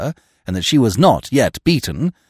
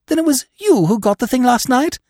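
high, louder incredulous question; amazed questioning; 13 semitones higher, 5 dB louder than usual. A narrator's reading. Freddy realises Eve got the necklace, amazed questioning. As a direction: ask it in high astonishment.

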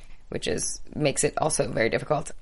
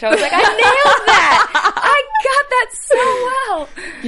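high, loud, slow laughing shout; triumphant shout; 9 dB louder, much slower than usual. Retold or imitated. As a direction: crow over a silly win. Retold triumph at charades.

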